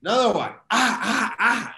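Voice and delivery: loud, high cries in short bursts; imitated pained cries. Swimmer reliving another breath of water, loud imitated cries. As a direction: cry out harder with each breath. Re-enacts second painful breath out.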